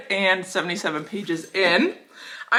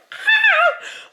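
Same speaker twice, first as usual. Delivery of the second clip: shrieking giggle, much higher; high squealing laugh. Real, not acted. Reader squealing at the book's spicy line, high giggle. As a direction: squeal with delight.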